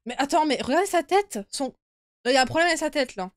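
loud, high alarmed voice; startled and rattled; scared horror game streamer. Real, not acted. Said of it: "ça m'a fait trop peur".

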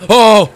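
sudden loud, high "oh"; startled yelp. Real, not acted. Player scared by a near hit, high startled yelp. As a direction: cry out in sudden fright.

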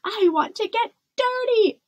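high, loud defiant cry. A narrator's reading. Rebellious princess bouncing on the bed, crying out. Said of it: "cried Isabella".

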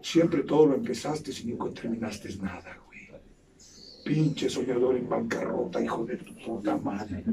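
low, soft and scornful; quiet contempt. Acted. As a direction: murmur the insult down low with disdain. Desprecio en voz baja. (desprecio en voz baja)

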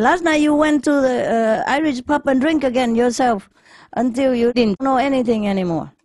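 put-on an angry wife scolding her drunk husband. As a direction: tell him off like a cross wife.

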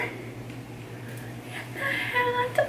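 high, slow, hushed gasp of awe; breathless whisper. Real, not acted. Mom-to-be overwhelmed by the tiny hat, awed whisper. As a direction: barely breathe it out.

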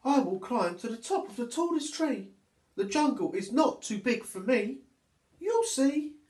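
a small monkey boldly announcing she'll climb; determined little monkey. Acted. Monkey speaks her brave decision.

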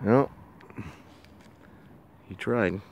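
ordinary delivery

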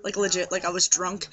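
usual speaking voice

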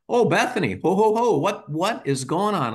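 put-on dad finding his young daughter at the fridge, cheerful and smiling; smiling gentle dad. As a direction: greet her kindly with a smile. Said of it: "I get down on her level with a smile".

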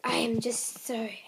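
soft hushed whisper, words barely voiced; whispered anger. Real, not acted. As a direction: breathe the anger out under your breath. Upset kid ranting about dogs.

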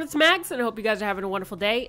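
usual speaking voice

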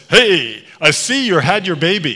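loud, hearty greeting; big, chummy acted hail; friendly acquaintance. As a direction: boom out a hello, imitating a jovial man. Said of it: "He takes the baby and says".

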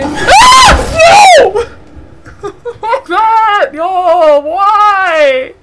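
very high, loud shrieks; terrified screaming. Real, not acted. Streamer screaming after the monster lunges. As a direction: scream at the jump scare.

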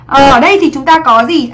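ordinary talk, nothing marked

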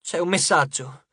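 small, shaken announcement; feebly, as if still in shock; understated frightened voice; faint, cracked voice. A narrator's reading. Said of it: "La voce di Piper era fievole e incrinata".

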